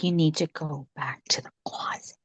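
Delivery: whispered, soft command to a ghost; whispered order. Retold or imitated. Guest sending the ghost back to the closet, whispering. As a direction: whisper it firmly so the ghost obeys.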